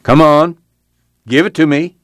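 voice rising. A narrator's reading. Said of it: "His voice began to rise".

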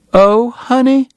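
high-pitched fussing Southern lady; crying out in concern. A narrator's reading. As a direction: exclaim it high, full of motherly alarm. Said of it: "she cried".

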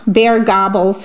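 usual speaking voice

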